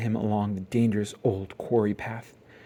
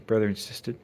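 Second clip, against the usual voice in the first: ordinary delivery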